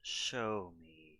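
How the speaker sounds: low unvoiced whisper; stage whisper. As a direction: breathe it in a whisper. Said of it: "stage whisper voice".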